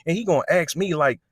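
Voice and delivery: hammed-up angry youth, voice pushed up; a mock indignant teen complaining to the officer. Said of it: "So he was like".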